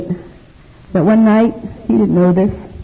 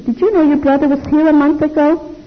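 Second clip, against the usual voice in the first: friend's news; 9 semitones higher than usual. Retold or imitated. Voicing Jimmy Fox's news.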